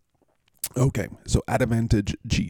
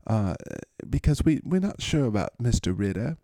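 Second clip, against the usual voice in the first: higher, put-on London accent; 3 semitones higher than usual. Host picking the matchup advantage, in a mock London accent. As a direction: analyse it as a mock English pundit. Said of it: "I like to say that in that accent".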